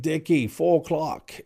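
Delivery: mock crew voice, lightly done; crew warning. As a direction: tip him off like a lookout. Crew warning diver of sharks.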